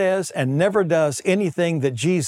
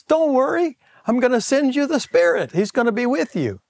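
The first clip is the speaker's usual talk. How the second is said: much higher, bright encouraging promise; reassuring Jesus; 9 semitones higher than usual. Retold or imitated. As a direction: lift the disciples' spirits. Preacher voices Jesus to disciples.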